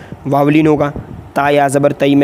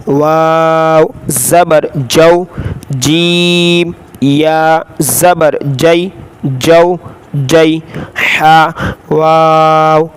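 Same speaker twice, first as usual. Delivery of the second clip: Qaida spelling drill; much slower than usual. Shown as a demonstration. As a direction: call out each letter for the class to repeat.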